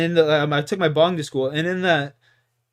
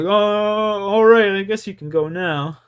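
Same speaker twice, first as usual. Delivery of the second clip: grudging teacher; 5 semitones higher, 5 dB louder than usual. Retold or imitated. Teacher gives up the search.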